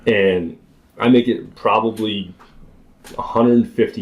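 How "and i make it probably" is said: ordinary delivery, nothing marked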